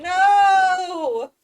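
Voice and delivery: sudden high, loud cry of no; alarmed shout; panicked no. Real, not acted. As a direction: yell it out as the sun sets. Panicking at the sunset.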